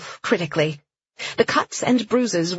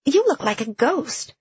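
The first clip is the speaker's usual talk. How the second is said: friend's remark; 7 semitones higher than usual. A narrator's reading. Friend remarks on her looks.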